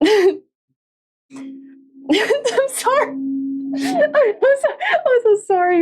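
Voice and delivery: very high, giggling sorry; laughing apology. Real, not acted. Streamer apologising to chat through laughter.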